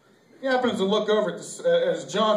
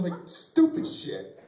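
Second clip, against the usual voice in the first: usual speaking voice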